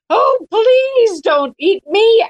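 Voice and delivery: high, slow, pleading little mouse; frightened prey animal. Acted. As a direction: beg for your life like a tiny mouse. Mouse begs lion in fable.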